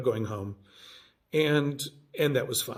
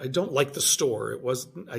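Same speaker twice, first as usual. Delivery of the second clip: ordinary talk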